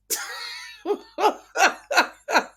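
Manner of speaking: high burst of laughter; real laugh. Real, not acted. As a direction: laugh hard at your own joke. Laughing at her own joke.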